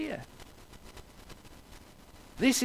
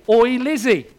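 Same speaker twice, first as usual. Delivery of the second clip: loud, higher, brash familiar call to a queen; cheeky casual greeting; 5 semitones higher, 8 dB louder than usual. Retold or imitated. Commoner hailing the Queen at Downton, cheekily loud. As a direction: toss it off like a cheeky mate hailing her. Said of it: "You're not gonna see anybody speak like that".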